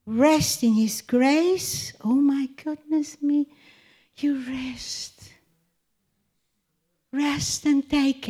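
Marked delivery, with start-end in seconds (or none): 4.2-5.3 whisper. Real, not acted. Gentle hush in devotional talk.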